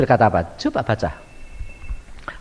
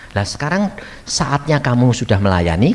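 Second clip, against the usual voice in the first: ordinary talk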